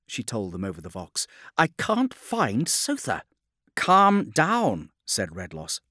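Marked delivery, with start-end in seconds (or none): 1.6-3.2 slow, high-pitched distressed navigator; extremely upset. A narrator's reading. Navigator telling the fleet she cannot find Sotha, upset. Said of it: "She was also extremely upset". 3.8-4.9 high, loud order to a panicking officer; firm command. A narrator's reading. Redloss to an upset navigator, firmly commanding.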